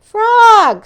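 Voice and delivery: high loud worried call; calling into the dark; loud anxious call. Acted. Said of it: "said Toad".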